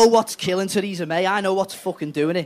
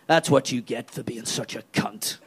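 lower, sneering with a laugh; sneering tree; 6 semitones lower than usual. Retold or imitated. The tree sneers at homeless Theresa May, low. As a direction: look down on her and jeer. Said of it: "the tree will turn to her and goes".

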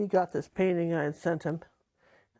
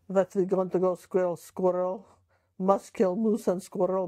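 Boris Badenov accent. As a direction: ham up a cartoon Russian spy accent. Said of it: "your cartoon voice".

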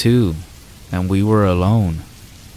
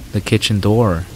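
plain reading voice

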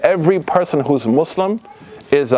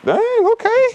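sudden high squeak of shock; shocked dang; slower than usual. Retold or imitated. Telling off a cussing friend in the car, shocked high squeak. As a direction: yelp it out, caught off guard. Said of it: "I was like".

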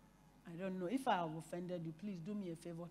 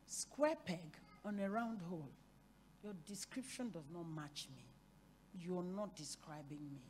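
calm refusal; 2 semitones lower, 7 dB quieter than usual. Retold or imitated. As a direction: quietly decline to argue, voice kept down. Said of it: "I didn't go jawing jaw with her".

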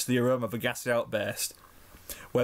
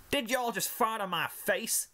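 put-on Southern accent; 10 semitones higher, slower than usual. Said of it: "the southern accented filly asked".

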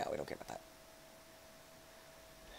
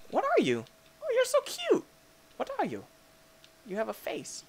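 cooing; 4 dB quieter than usual. Real, not acted. Player meeting a cute creature, high cooing. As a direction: coo at it in a tiny high voice.

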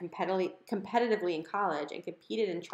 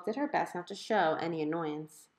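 ordinary delivery, nothing marked

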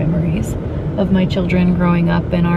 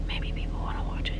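whispering; 12 dB quieter than usual. Real, not acted. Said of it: "I have to whisper".

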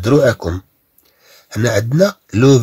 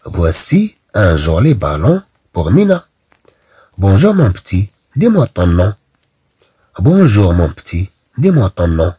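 slow, louder classroom read-aloud; 5 dB louder, much slower than usual. Shown as a demonstration. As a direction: read each sentence aloud for the class to follow. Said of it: "إذا نعيد". (قراءة جهرية بطيئة للقسم)

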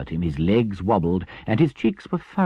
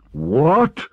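loud, high cry of outrage; appalled Badger; scandalized; 12 semitones higher, 9 dB louder than usual. A narrator's reading. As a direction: explode in shocked disbelief. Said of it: "cried the Badger, greatly scandalized".

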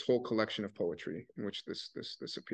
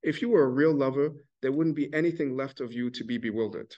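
pointed rebuke; 4 semitones higher, 4 dB louder than usual. Retold or imitated. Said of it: "here she says".

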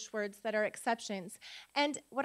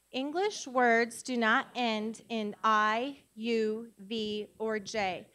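very slow, measured, word by word; slow recitation; 2 semitones lower, 4 dB louder, much slower than usual. Shown as a demonstration. Teacher leading class in a spelling rule, slow recitation. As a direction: recite it deliberately so a group can join in. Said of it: "say it with me".

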